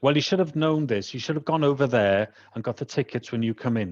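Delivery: imitated telling-off with a Welsh accent creeping in. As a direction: retell her scolding in a lilting Welsh accent. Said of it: "she didn't have a Welsh accent".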